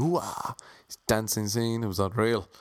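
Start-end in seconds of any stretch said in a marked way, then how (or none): 0.0-0.5 high, drawn-out catchphrase whoop; Al Pacino. Retold or imitated. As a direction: mimic the movie star's swooping cry. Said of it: "Al Pacino's going".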